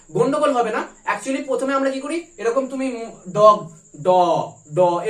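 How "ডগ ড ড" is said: loud, slow "ডগ" and "ড" with a full d from closed mouth; wrong method demo. Shown as a demonstration. Teacher shows how not to test, loud slow "ডগ, ড, ড" with full d. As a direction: hit each "ড" hard and separately, tongue making the d. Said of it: "এরকম বলছি না".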